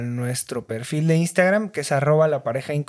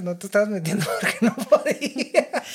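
laughing; 5 semitones higher than usual. Real, not acted. Teasing friend, laughing.

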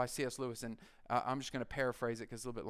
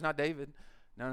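ordinary delivery

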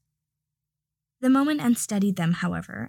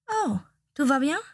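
put-on high, sing-song French accent greeting; a cheery French shop assistant chiming in; Nicolas, French chime; 5 semitones higher than usual. French assistant asks, concerned.

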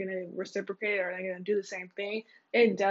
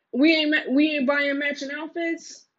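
loud ranting; 6 semitones higher, 9 dB louder than usual. Real, not acted. Heated rant.

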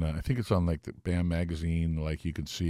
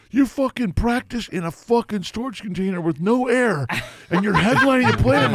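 much higher, louder, laughing disbelief; mock astonishment; 13 semitones higher, 6 dB louder than usual. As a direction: squeal it in amazed disbelief. [name] shocked, laughing.

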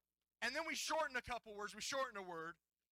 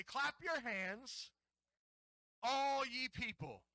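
slow, higher-pitched proclamation; scripture call-and-response; 4 semitones higher, 4 dB louder, much slower than usual. Real, not acted. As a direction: lead the congregation through it deliberately. Leads congregation in the verse.

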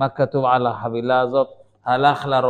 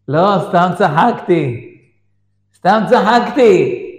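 loud, high, stretched reassurance; insistent dismissal; 7 semitones higher, 9 dB louder than usual. Retold or imitated. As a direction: brush it off emphatically as the wife. האישה מבטלת את ההתפרצות. (ביטול נמרץ בקול רם)

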